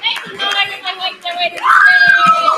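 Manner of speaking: loud, high crowd-pumping shout; hype shout. Real, not acted. Host urges the crowd to cheer, shouting high. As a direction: whip the audience into cheering.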